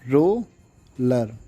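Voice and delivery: Roller drawn out very slowly while writing; slow spelled-out word. Shown as a demonstration. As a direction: stretch roller syllable by syllable, as if tracing each stroke. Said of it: "तो roller कैसे?".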